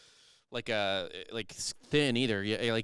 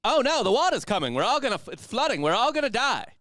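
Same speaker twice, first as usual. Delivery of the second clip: loud, high panicked announcer; Mid-Atlantic announcer voice; 13 semitones higher, 7 dB louder than usual. Pretend. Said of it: "classic Mid-Atlantic is my announcer voice".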